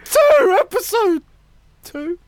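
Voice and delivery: high, loud, slow scream; screamed announcement. Acted. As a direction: belt the intro with full force. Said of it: "you've gotta scream it".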